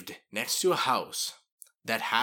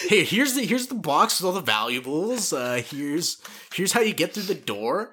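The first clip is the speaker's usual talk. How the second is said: higher, louder eager dog; helpful dog voice; 7 semitones higher, 5 dB louder than usual. Retold or imitated. Voicing the dog helping burglars, higher eager tone. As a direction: offer it up like a traitor pup. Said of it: "This guy will help the people".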